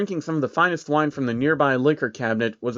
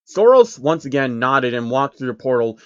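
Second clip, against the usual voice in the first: plain reading voice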